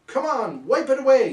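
loud, high cry to wipe it away; high urgent shout; yelling. Acted. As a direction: shout it out like a rallying call. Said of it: "yelled Senator A".

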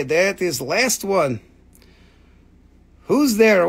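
ordinary talk

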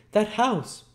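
put-on loud, very high boy's exclamation; alarmed cry as Oliver. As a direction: point it out urgently as a frightened child. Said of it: "cried Oliver".

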